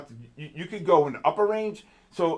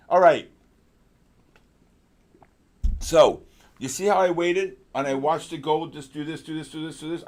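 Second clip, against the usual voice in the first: ordinary talk, nothing marked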